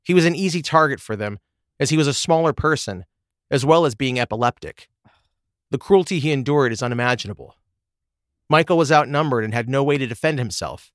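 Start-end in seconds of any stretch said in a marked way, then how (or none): none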